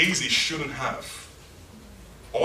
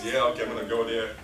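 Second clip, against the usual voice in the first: half-hearted compliance; 5 semitones lower than usual. Acted. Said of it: "Gehazi was just compliant".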